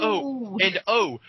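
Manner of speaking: ordinary delivery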